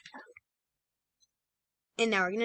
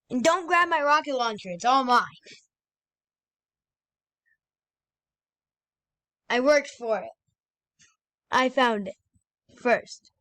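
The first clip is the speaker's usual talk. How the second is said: loud protest; 3 semitones higher, 5 dB louder than usual. Real, not acted. Guarding loot.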